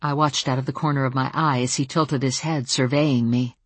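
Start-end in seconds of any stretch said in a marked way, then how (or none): none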